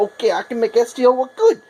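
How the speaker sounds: voicing the software. Printer software voiced as talking.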